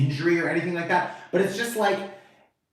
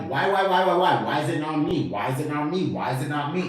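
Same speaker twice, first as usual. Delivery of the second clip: ordinary talk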